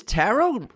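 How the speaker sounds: "tarot" with the final T silent; pronunciation query. Shown as a demonstration. Co-host asks if it's silent T, unsure pronunciation. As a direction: say "tarot" ending on the vowel. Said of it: "is this silent T?".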